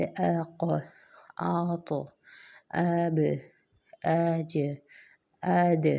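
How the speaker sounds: each letter stopped then released with an echoing bounce, اق اب اج اد; qalqala echo. Shown as a demonstration. Teacher showing qalqala letters, echoing release. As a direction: bounce the tongue off the letter so it echoes. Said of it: "ایک ایکو کی آواز آئے گی".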